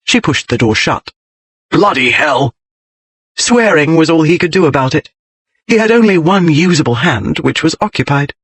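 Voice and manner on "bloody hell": a read-aloud curse, mildly shouted; angry oath. A narrator's reading. Trapped husband swears aloud.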